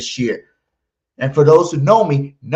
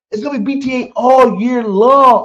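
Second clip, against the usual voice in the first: hype shout; 9 semitones higher, 5 dB louder than usual. Real, not acted. Hype call repeated louder.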